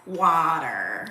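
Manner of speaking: slow American water with hard r; drawn-out American water; American accent. Shown as a demonstration. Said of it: "if you say it in America".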